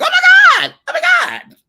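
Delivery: re-enacted very high, loud shriek; screaming reenactment of excited disbelief; excited outburst. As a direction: shriek it as if reliving the thrill. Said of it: "I got so excited".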